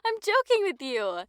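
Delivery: high, loud amused reassurance; laughing, teasing. Acted. Telling a friend it was a joke, laughing. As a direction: laugh it off playfully.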